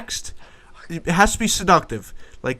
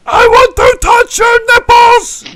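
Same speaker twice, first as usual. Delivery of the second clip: shrieked high and loud; mock lusty shout; 16 dB louder, slower than usual. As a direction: screech it like a crazed lover. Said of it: "Nothing like".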